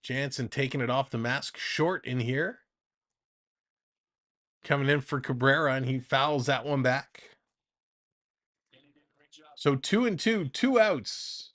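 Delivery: lively baseball announcer delivery; play-by-play call. Acted. Streamer calling the Jays game for chat, announcer voice. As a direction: call the at-bat like a broadcast.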